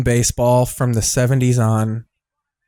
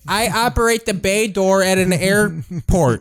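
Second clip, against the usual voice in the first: mock Chicago airport worker; 5 semitones higher than usual. Continues the Chicago worker bit.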